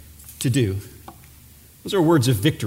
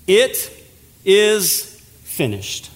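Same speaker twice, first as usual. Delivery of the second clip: "It" held apart, then a slow "is finished"; weighty pause after "It", falling close; solemn victory words; much slower than usual. Retold or imitated. Preacher quoting Jesus' words of victory, slow and solemn. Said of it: "he shouts words of victory".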